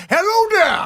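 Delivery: high, hammed-up South African accent; DiCaprio's Blood Diamond accent mocked, high and hammy. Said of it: "his South African accent".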